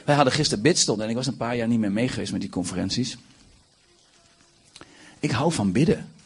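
normal talking voice

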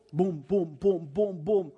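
knocking sounds. Acted. Imitating knocking on door.

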